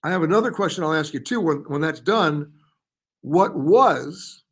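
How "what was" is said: WAS punched louder, held before a pause; stress on WAS. Real, not acted. Host posing a trivia question to viewers, stress on WAS. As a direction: lean hard on the word "was". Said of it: "emphasis on the word was".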